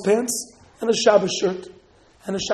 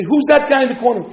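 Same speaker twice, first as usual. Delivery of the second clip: loud, raised-pitch soldier's challenge; demanding interrogation; 4 semitones higher, 9 dB louder than usual. Acted. Soldier spotting someone in the corner, demanding. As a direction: demand it loudly as a searching soldier.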